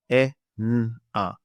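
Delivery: E, n, a as three separate sounds. Shown as a demonstration. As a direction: sound out "E, n, a" one by one, with pauses. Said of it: "so I will say it now".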